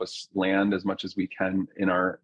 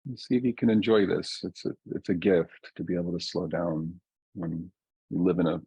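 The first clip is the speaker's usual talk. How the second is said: guided meditation. Real, not acted. Teacher settling a group into their bodies, calm guided meditation. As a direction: ease listeners down gently, leaving long silences.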